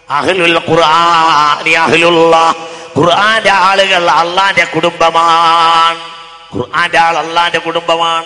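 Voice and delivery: loud chanted sermon with drawn-out endings; sing-song preaching. Acted. Preacher reciting scripture to the congregation, chanted preaching. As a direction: preach it out, stretching the last syllables.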